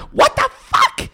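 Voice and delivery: very high, loud mock mom shriek; mother yelling across the street, shrill and high; screaming mom. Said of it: "my mom was across the street".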